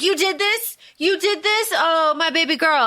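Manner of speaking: high, gushing imitation of weepy parents; mock crying parents. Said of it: "they're crying and they're like".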